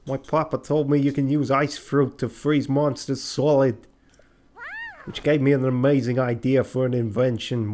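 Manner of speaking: loud, hammed-up New York accent. Telling a customer about an ice fruit invention, put-on New York accent. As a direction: play it as a busy, brash New Yorker. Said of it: "like, a New York accent".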